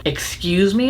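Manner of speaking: normal talking voice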